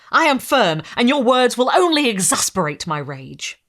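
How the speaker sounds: loud, resolute fury; defiant anger. Acted. Victor defying the creature, loud and resolute. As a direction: stand firm and rage at him.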